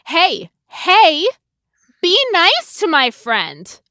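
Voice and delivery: normal talking voice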